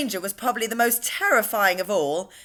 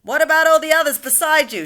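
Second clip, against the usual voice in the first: higher, much louder shout; 7 semitones higher, 8 dB louder, faster than usual. A narrator's reading. Police chief shouting up to James on the peach. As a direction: bellow the question up to the boy. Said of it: "shouted the chief of police".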